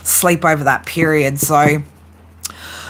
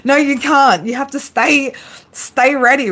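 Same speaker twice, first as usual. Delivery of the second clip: higher, teasing mock voice of Spirit; voicing Spirit; 7 semitones higher than usual. Spirit teasing the reader who wants a break, higher mock voice. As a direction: play Spirit gently scolding, pitched up with a laugh. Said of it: "Spirit's like".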